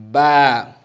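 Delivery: ba said loud and crisp; teacher demo ba. Shown as a demonstration. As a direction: model ba for the class to repeat. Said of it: "say it after me".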